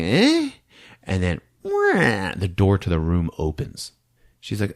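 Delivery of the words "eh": much higher puzzled grunt; a confused little eh as the dolls vanish. Retold or imitated. A girl finding the dolls gone, confused.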